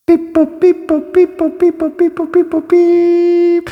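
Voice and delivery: very high robotic beep boop chant, slowing at end. Acted. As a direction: chirp like a little robot. Second take of transition.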